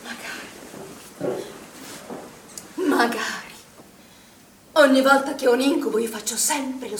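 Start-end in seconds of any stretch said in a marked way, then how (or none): 0.0-1.4 whispered. Acted. Wishing she could forget.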